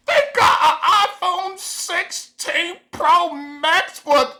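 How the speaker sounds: high, slow, laughing delight; gleeful laughing disbelief. Retold or imitated. Reading phone reviews to herself, gleeful laughing disbelief. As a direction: laugh it out, unable to believe the luck. Gloating over the phone deal.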